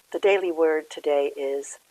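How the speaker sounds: ordinary talk, nothing marked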